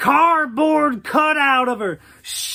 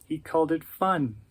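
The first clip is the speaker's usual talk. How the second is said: a much lower and softer second voice; a sour grown man grumbling, lower and softer; low gruff other character; 9 semitones lower, 8 dB quieter, faster than usual. Acted. Low far interjecting voice.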